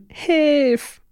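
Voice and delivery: put-on high, plaintive child's cry for help; little girl whining for help; whiny child. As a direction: whine it like a small girl. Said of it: "wenn sie sowas sagt wie".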